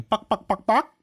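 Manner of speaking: high, squeaky mock chicken cluck. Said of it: "He got pretty good at going".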